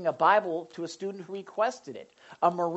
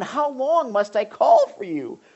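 high, loud, drawn-out plea; crying out; 8 semitones higher, 9 dB louder than usual. Acted. Prophet calling to God, crying out. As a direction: cry out slowly, leaning on CALL. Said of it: "he's crying".

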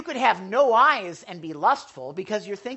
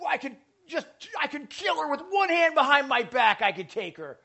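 put-on higher, boasting kid bragging; lightly voiced bragging kid; boastful kid; 8 semitones higher, 4 dB louder than usual. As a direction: brag like a cocky little brother. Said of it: "I was like".

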